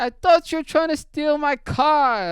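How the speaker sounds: high, hammed-up Jamaican wail; imitated Jamaican accent. Jamaican dude tells mechanic he suspected a car thief, high Jamaican accent. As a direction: pitch it way up in mock Jamaican. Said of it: "I don't even know what the accent he has".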